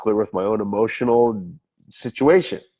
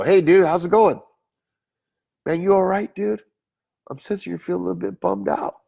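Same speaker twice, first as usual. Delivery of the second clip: casual friendly greeting; 9 semitones higher than usual. Retold or imitated. Greeting a sad stranger casually.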